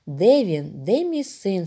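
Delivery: ordinary delivery, nothing marked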